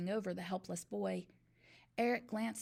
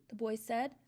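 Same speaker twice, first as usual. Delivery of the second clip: ordinary delivery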